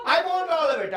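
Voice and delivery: high, loud demand in an Indian accent. Shown as a demonstration. Teacher models what to say at the buffet, loud Indian accent. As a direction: shout it like a hungry customer, Indian accent. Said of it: "I want you to go in there and say".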